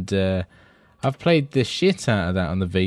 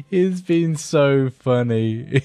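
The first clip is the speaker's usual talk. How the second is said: slow words squeezed out through laughter; laughing; 2 semitones higher, slower than usual. Real, not acted. Co-host tickled by the slipping camera, laughing. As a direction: still giggling while speaking. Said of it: "I'm just laughing".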